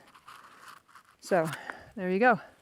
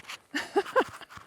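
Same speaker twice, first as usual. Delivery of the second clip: breathy high giggle; 12 semitones higher, 3 dB quieter than usual. Real, not acted. Laughs at own mood swings.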